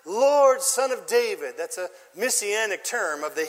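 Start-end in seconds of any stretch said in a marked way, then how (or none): 0.0-1.6 high, loud pleading cry; crying out all the more. A narrator's reading. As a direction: beg louder than the crowd's hush. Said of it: "All the more".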